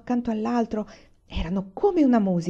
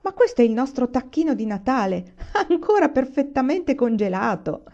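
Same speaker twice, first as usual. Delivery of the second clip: laughing with delight; 6 semitones higher, 4 dB louder than usual. Acted. Papà delighted by the turkey.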